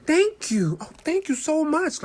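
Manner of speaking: high, loud gushing gratitude; gushing thanks. Retold or imitated. Mother thanking the lady who traded seats, gushing. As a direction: overdo the thanks like receiving a gift.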